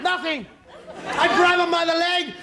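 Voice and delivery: ordinary talk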